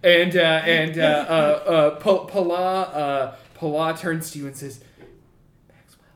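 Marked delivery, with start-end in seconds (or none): 5.7-6.1 urgent hushed whisper. Acted. Wife warning Maxwell to leave, hushed whisper. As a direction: warn him under your breath.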